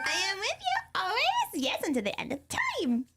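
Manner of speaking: high anime character, starting way up. Acted. Player retrying the verse in a high anime voice. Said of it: "My anime".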